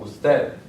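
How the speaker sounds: Usted stressed on TED; us-TED, hit the final syllable; stress on last syllable. Shown as a demonstration. Teacher demonstrating palabras agudas, stress on last syllable. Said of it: "be on the last syllable".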